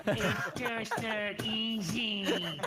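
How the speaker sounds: dragged out slowly through a laugh; slow laughing drawl. Acted. Repeating his own excuse to friends, slow and laughing. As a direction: stretch each word, laughing at yourself. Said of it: "It's all just not easy".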